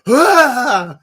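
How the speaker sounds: sudden high, loud scream of fright; startled scream. Retold or imitated. Retelling to viewers how the dog scared him, scream. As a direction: yelp like a dog just spooked you. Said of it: "I was like".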